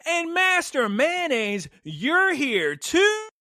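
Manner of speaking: high, drawn-out dramatic reveal; mock astonishment. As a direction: stretch it out in hammed-up amazement. Mock-astonished greeting of a character.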